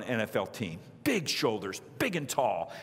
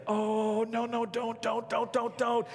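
mock dread; 5 semitones higher, slower than usual. Dreading the big guy's reaction.

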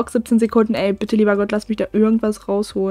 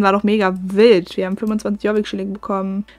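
ordinary talk, nothing marked

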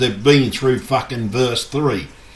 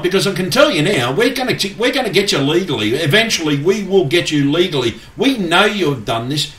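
raised, fast, heated accusations; angry rant; 6 semitones higher than usual. Real, not acted. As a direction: hurl the threats up high and fast. Furious threats aimed at CERN.

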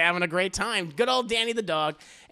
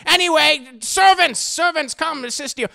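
higher, louder call; calling servants; 10 semitones higher, 9 dB louder than usual. Retold or imitated. Lord Joestar calling his servants, loud grand call.

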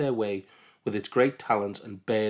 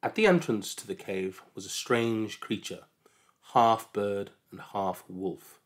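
ordinary delivery

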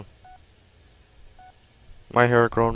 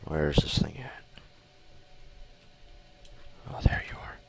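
whispering; 4 semitones lower, 8 dB quieter than usual. Real, not acted. Sneaking up in game.

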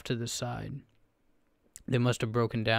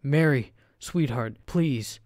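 desperate plea; 3 semitones higher, 5 dB louder, slower than usual. A narrator's reading. Old man pleading over wife.

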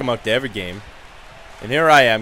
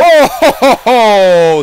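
loud, high mock Santa laugh; gloating laugh; 8 semitones higher, 9 dB louder, slower than usual. Player gloating at a knocked-down rival, loud mock laugh. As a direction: crow over the hit.

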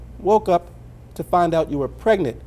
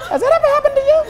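much higher teasing sing-song question; hammed-up teasing catchphrase; mock dramatic host; faster than usual. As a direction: toss it up high like a game-show host.

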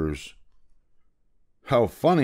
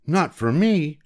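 put-on higher, rueful older lady; Aunt Ruby; 7 semitones higher than usual. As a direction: shaken aunt disagreeing.

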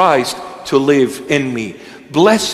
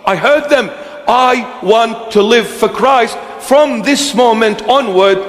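convert's fervent vow; 7 semitones higher than usual. Acted. Believer vowing to live for Christ, higher and fervent. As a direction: play the newly converted sinner swearing off his old life.

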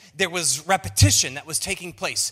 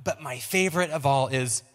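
ordinary talk, nothing marked

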